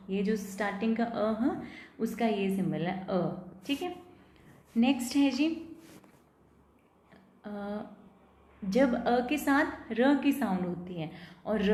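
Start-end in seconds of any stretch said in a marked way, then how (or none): none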